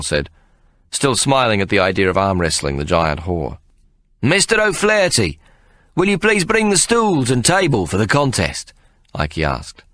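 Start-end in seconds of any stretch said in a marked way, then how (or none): 4.2-8.7 high called summons across the crowd; calling out. Acted. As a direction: call him over the heads. Ikey calls publican.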